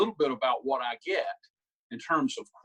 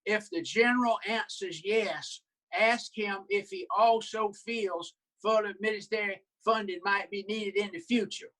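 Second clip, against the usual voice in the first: higher, old Southern senator impression; Strom Thurmond voice; 6 semitones higher than usual. Senator speaking on the Senate floor, higher old Southern senator voice. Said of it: "looked back at his notes and said".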